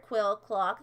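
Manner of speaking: ordinary delivery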